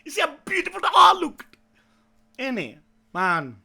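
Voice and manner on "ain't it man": normal talking voice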